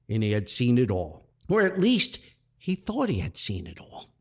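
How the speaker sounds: ordinary delivery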